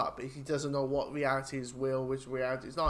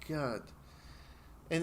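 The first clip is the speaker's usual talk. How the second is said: ordinary talk, nothing marked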